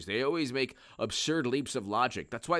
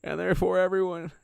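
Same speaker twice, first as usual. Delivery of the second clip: Bill Clinton voice; 6 semitones higher, 4 dB louder than usual. Retold or imitated. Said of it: "I was asked to do my Bill Clinton voice".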